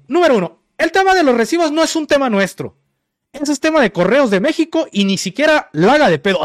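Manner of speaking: high-pitched mock yelling; imitated shouting. Clerk disowning the bills to a customer, in imitated shouting. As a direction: rattle off points like a yelling clerk. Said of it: "Y así gritando".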